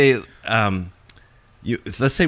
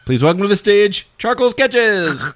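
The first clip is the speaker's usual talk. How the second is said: game show announcer; 14 semitones higher, 6 dB louder than usual. Pretend. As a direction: introduce the act like a ringmaster. Announcing a clown onstage.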